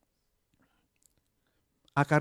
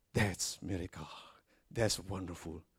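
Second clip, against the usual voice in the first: mock awe; 7 semitones lower, 11 dB quieter, slower than usual. Mocking awe at a miracle.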